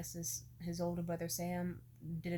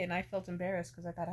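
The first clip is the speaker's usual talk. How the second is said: normal talking voice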